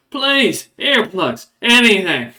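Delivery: high, loud desperate plea; hammed-up desperate pleading; begging dwarf prisoner. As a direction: beg the guard from the next cell. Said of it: "you hear him go".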